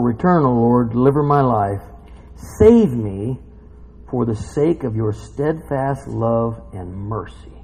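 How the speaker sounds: low, measured psalm reading; solemn scripture reading. Acted. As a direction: read the lament out gravely. Reads psalm aloud.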